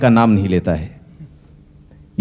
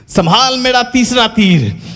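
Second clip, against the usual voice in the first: high, slow, drawn-out declamation; stagey heroic shout; 8 semitones higher than usual. Acted. Ram firing his second arrow, slowly declaiming at Ravan. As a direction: stretch every word like a stage hero.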